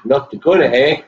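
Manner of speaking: put-on North Dakota accent. Cohost jokes about the driver's roots in a North Dakota accent. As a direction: tease her with a quick North Dakota accent. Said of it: "She's got that accent".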